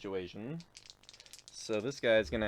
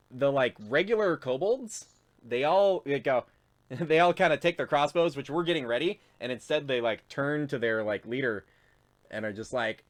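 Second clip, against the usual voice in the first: ordinary talk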